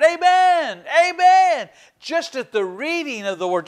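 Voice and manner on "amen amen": high-pitched shouted amens; raised, ringing cry of praise; crowd shouting. Acted. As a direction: call it out like a whole congregation answering. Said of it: "all the people shouted".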